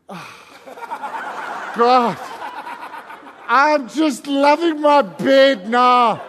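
slow, drawn-out comic complaint to God; hammed-up reluctant moan; whiny protest. Mock protest at being disturbed.

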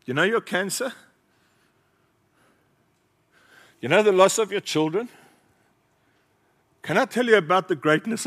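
fiery pulpit delivery, voice lifted high; preaching. Acted. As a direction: press the crowd hard with each question. Preacher rising to full force.

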